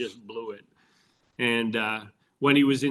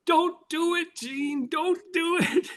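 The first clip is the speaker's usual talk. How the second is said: high, drawn-out pleading holler; mock yell pitched way up high; imitated yell; slower than usual. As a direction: imitate a broadcaster wailing a warning from afar. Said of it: "Dave's yelling".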